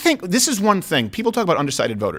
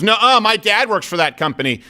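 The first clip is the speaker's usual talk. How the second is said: higher-pitched mimicry of a schoolboy; childish denial; 5 semitones higher than usual. Accused classmate contradicting the boy before the teacher, childish mock protest. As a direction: imitate a kid stubbornly copying the claim. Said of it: "And then he goes".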